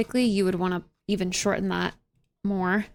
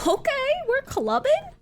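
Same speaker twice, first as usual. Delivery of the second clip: amused surprise. Real, not acted. Reacting to club music.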